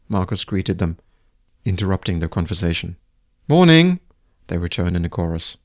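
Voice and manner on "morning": bright greeting. Acted. They reply in chorus.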